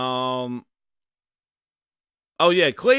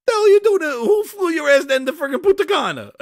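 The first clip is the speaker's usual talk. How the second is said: much higher, louder laughing disbelief; incredulous yell; 13 semitones higher, 6 dB louder than usual. Real, not acted. Streamer yelling at Phil over the Punta Cana trip, incredulous. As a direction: shout it back at him.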